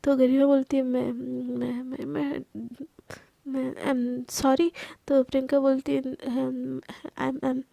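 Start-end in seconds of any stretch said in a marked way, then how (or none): 0.8-4.7 slow, softer, halting apology; awkward stammer. Acted. Garima embarrassed after the sudden hug, stammering slowly. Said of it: "थोड़े से awkward होने लगते". 6.0-7.6 awkward stammer. Acted. Priyanka embarrassed after the hug, stammering her apology. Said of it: "थोड़े से awkward होने लगते".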